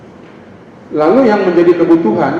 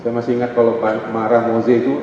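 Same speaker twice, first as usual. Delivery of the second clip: ordinary talk, nothing marked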